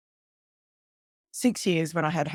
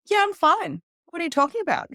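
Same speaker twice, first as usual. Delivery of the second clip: defensive denial; 13 semitones higher, 6 dB louder than usual. Retold or imitated. Brushing off mum's drinking question.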